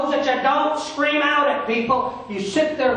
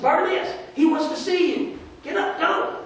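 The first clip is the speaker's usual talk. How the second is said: calling out; 7 semitones higher than usual. Acted. Crowd calls the blind man.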